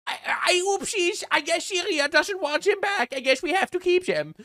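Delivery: very high, simpering German caricature; mock-apologetic German squeak; meek mock German. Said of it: "Germany is just so absolutely cucked".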